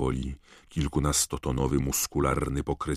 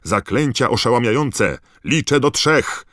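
much higher and louder orders; shouted command; 8 semitones higher, 7 dB louder than usual. A narrator's reading. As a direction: bark the count to the team. Wizard commanding stunning spells.

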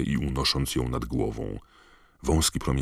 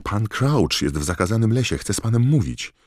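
raised, high urgent voice; Harry calling out; 8 semitones higher, 7 dB louder than usual. A narrator's reading. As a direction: blurt the news loudly before anyone cuts in. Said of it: "zawołał Harry". (głośno, wysoko, z naciskiem)

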